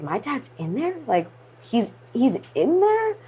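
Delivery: rising, high-pitched incredulous question; bewildered re-enacted questioning; stunned disbelief. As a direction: relive the shock, voice climbing at the end. Reliving shock at the TV.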